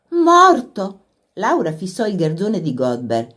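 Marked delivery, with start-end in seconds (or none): 0.1-1.0 high, loud gasped question; shocked cry. A narrator's reading. Laura hearing the carter is dead, a shocked high cry. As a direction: blurt it out in horror.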